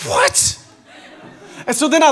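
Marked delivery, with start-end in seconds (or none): 0.1-0.6 squeaky high stunned what; shocked what. Retold or imitated. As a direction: act floored by the news. Reacting to wife's call.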